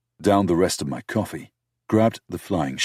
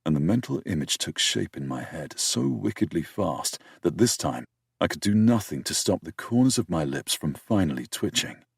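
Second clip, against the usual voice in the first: ordinary delivery, nothing marked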